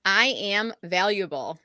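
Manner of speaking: slow, deliberate affirmation. Shown as a demonstration. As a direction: lead it slowly, word by word. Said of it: "I'm gonna say the line".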